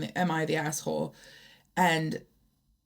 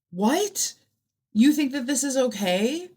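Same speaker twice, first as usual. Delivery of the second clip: a much higher, stunned "what?"; incredulous; 7 semitones higher than usual. Real, not acted. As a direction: pitch it up in disbelief. Outraged at the poster.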